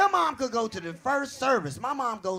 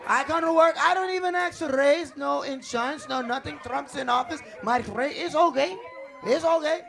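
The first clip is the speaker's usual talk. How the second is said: put-on higher-pitched Spanish-accented protest; 4 semitones higher, 3 dB louder than usual. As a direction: plead your case in a Spanish accent. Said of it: "fire a Spanish person?".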